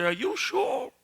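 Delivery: surprised. Acted. Customer doubting the medicine.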